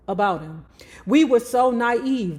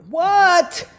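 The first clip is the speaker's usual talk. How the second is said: a sharp, higher, louder demanding What?; 9 semitones higher, 5 dB louder than usual. Retold or imitated. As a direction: demand the answer right now. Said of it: "I demanded".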